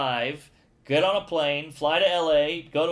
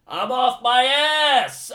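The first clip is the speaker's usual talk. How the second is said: high, loud, drawn-out yell; stunned yell; 9 semitones higher, 7 dB louder, much slower than usual. Retold or imitated. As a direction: stretch it out into a big comic holler. Retelling waking up extremely high.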